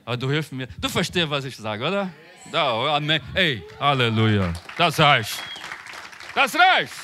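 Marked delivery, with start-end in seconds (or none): none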